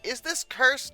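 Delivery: normal talking voice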